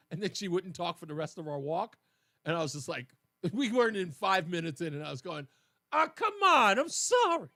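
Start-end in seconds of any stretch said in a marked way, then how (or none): 5.9-7.5 high, loud pleading apology; retold desperate begging, hammed up. Husband begging silent wife on a walk, hammed-up plea. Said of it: "I was begging her".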